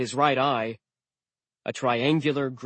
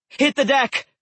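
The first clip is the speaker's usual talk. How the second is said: loud, high yell; shouted warning; 13 semitones higher, 7 dB louder than usual. A narrator's reading. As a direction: shout them down to the ground.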